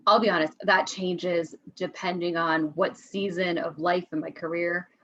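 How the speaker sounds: usual speaking voice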